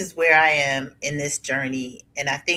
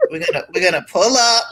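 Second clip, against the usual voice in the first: louder, quick, laughing imitation of a catchphrase; 3 semitones higher, 9 dB louder, much faster than usual. Teasing her partner with the favorite phrase, laughing. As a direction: quote it through laughter, loud and fast.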